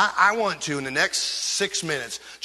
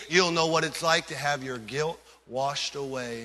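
ordinary talk, nothing marked

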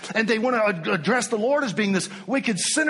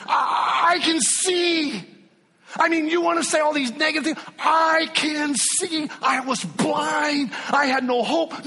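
shouted testimony; 9 semitones higher than usual. Acted. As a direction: cry it out like a man who was blind. Healed man's testimony, preached at full force.

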